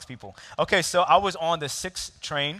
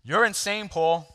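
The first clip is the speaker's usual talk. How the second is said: mild read shout; 5 semitones higher, 4 dB louder, slower than usual. A narrator's reading. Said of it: "was the shout of the man".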